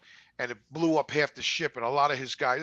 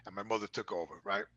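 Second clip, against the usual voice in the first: ordinary talk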